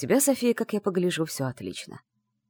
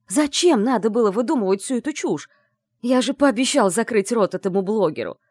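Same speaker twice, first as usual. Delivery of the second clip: angry scolding; 4 semitones higher, 6 dB louder than usual. Acted. Andrey scolds her loudly.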